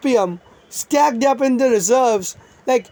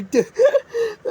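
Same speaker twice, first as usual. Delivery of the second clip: high laugh; 10 semitones higher than usual. Real, not acted. Laughs mid-list of invaders.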